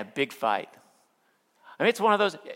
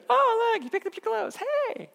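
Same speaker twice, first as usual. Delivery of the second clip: much higher, delighted mock wife voice. Husband imitating his happy wife praising him, delighted. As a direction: gush like a pleased wife.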